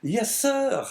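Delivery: high, drawn-out surprised reply. A narrator's reading. Innkeeper answering the priest's question, surprised. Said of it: "even more surprised at the question".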